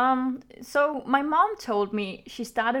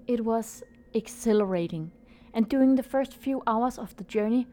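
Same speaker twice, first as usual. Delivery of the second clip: story narration. A narrator's reading. Reads the story over music.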